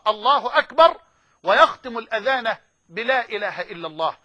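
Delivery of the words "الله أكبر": call to prayer. Acted. Voicing Bilal's call to prayer.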